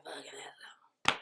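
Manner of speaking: slow whisper; whispered giving in. Retold or imitated. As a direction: give up in a hushed slow whisper. Group gives in.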